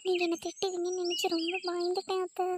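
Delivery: sweet girlish voice with a hint of fear; timid young wife. Wife telling husband she fears his mother, high and timid.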